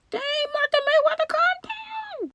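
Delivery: very high falsetto teasing cry; mock squeal. Teasing Martha in a squeaky falsetto to calm down.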